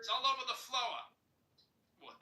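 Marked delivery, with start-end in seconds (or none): 0.0-1.0 loud, high exaggerated Boston accent. Shown as a demonstration. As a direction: belt it out like a Boston mom. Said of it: "That's spot on".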